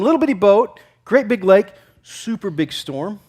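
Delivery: normal talking voice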